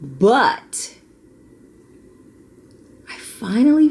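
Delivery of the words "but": ordinary talk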